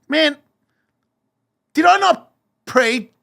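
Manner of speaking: high, loud, incredulous rant; angry outburst. Retold or imitated. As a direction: throw it back in disbelief, shouting. Retold furious rant at God.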